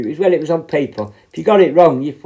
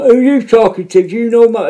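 loud, high confrontational challenge; imitated shouting; 9 semitones higher, 6 dB louder than usual. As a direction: imitate someone shouting in your face. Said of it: "someone fucking shouting".

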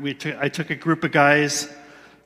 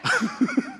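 high incredulous laugh; 4 dB quieter than usual. Real, not acted. Incredulous laugh mid-quote.

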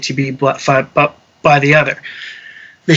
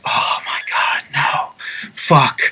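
retold sinking dread, almost whispered; soft, voiceless disbelief; hushed dismay; 3 semitones lower, 5 dB quieter, slower than usual. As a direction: let the words sink out softly. Dismayed at seeing the stranded crowd.

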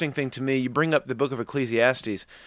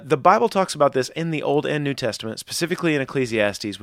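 ordinary delivery

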